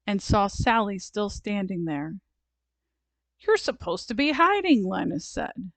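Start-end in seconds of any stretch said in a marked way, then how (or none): 3.4-4.8 put-on higher-pitched childlike protest; a touch of exasperated scolding; indignant. A narrator's reading. Linus scolds Sally in game.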